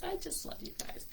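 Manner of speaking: soft breathy whisper, no voice; whispered repeat. Real, not acted. Host whispering her love to the group, whispered. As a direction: hush it like a secret.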